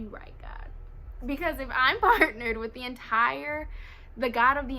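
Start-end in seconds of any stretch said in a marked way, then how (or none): 0.0-0.7 breathy, unvoiced hush of agreement; whisper. Answering God in a hushed whisper. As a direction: whisper it like a small confession.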